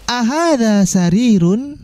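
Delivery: slow model reading. Shown as a demonstration. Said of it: "Pertanyaannya menggunakan A".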